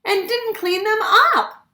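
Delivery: a high, put-out mum complaining; mother's voice. A narrator's reading. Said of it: "added Missus Chan".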